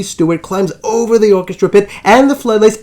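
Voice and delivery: usual speaking voice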